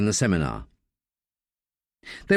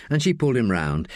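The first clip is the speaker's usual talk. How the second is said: ordinary delivery, nothing marked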